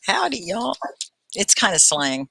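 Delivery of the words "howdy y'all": Southern greeting. Pretend. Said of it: "it's very Southern".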